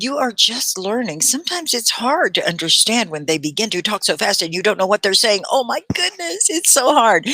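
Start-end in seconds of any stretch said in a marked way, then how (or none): 5.5-7.3 high-pitched mock dismay; overwhelmed learner. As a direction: act out a flustered student, pitched way up.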